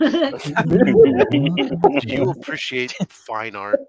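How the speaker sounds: slow, high, loud and drawn out; drawn-out sultry pitch. Shown as a demonstration. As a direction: stretch every word like a seductive pitch.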